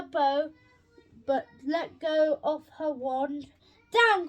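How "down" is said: higher, louder call of Down; a quick shouted order; witch cries out. A narrator's reading. Witch orders the broom down, shouting. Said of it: "cried the witch".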